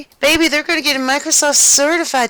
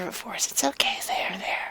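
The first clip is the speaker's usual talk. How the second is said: whispered there-there, much softer; soothing whisper; 12 dB quieter than usual. Acted. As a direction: hush her like a crying child. Husband hushing crying wife.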